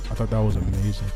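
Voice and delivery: normal talking voice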